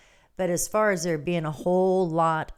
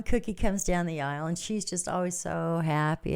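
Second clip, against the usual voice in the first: ordinary talk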